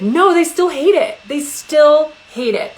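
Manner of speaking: mock-exasperated loud cry; emphatic outburst. Real, not acted. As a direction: blurt it out in exasperation. Exasperated admission about picky kids.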